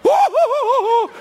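high, loud whooping; shrieking woo at a snake kill; excited whooping. Retold or imitated. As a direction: whoop like you just saw a miracle. Reacting to snake, shouted high.

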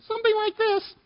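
put-on boy straining to sound big and tough; mock tough-guy voice from a young teen; deepest junior high voice. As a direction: put on your toughest kid voice. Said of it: "in my deepest junior high voice".

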